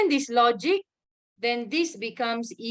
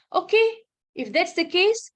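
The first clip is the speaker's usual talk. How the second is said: excited; 7 semitones higher, 4 dB louder than usual. Real, not acted. Teacher rallies class to questions.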